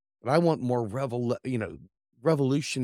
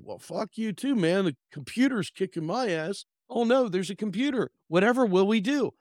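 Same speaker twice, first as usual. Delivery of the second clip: higher, mock-dramatic cartoon voice; hammed-up huff, then fake despair; sulky calculator; 7 semitones higher than usual. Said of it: "calculator's like".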